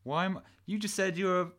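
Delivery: high-pitched incredulous challenge; exasperated protest. Acted. Questioning the self-styled rioter, high exasperated voice. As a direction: voice rising in disbelief at the excuse.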